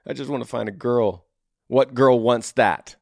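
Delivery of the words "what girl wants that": ordinary talk, nothing marked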